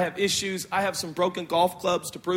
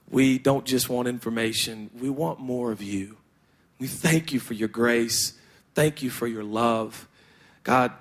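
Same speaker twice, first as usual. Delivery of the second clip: prayer; 9 semitones lower than usual. Acted. Opening prayer.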